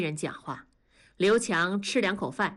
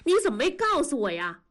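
fast, higher-pitched reproach; angry; 6 semitones higher, faster than usual. A narrator's reading. Yanni confronting Liu Qiang about his hidden crash, angry. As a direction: fire off the question, angry and upset. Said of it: "燕妮气得发抖".

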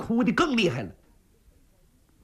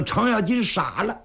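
ordinary delivery, nothing marked

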